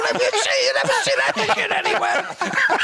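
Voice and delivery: high-pitched, worked-up mock protest; excited yelling. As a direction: act out a fan who won't calm down. Retold overexcited reply to crowd.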